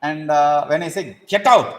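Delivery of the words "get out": loud, higher barked get out; angry get out. Shown as a demonstration. Teacher shows harshly dismissing a clinging disciple, angrily. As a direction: throw him out with mock anger.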